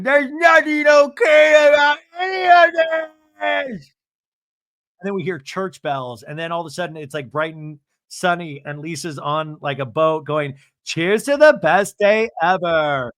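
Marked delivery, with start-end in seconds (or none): 0.0-3.9 hammed-up crying, high wailing shout; Meredith. Crying at the dinner table, high mock wail. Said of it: "like, crying at the dinner table going". 10.8-13.1 mock celebratory, drawn out and louder; cheery toast. Lisa toasting on a boat, loud mock cheer.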